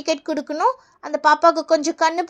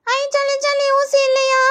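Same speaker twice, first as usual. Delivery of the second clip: high, gleeful schoolkid; delighted child; 5 semitones higher than usual. Acted. Pupil happy to skip the injection, gleeful child voice. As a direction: cheer like a kid let off.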